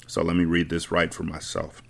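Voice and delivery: ordinary delivery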